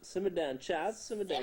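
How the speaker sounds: high, loud mock scolding; hammed-up "simmer down" from a skit; quoting a comedy skit. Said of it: "that S N L skit".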